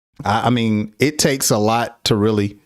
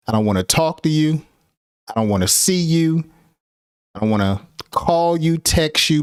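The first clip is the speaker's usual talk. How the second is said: higher, faster annoyed outburst; fast irritated rant; 5 semitones higher than usual. Shown as a demonstration. Teacher acts an annoyed person, fast higher rant. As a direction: rattle off the complaints. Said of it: "when people are angry".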